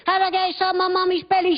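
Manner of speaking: put-on high, curious child's voice; child's question. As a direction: ask it like a small kid. Child voiced in parent scene.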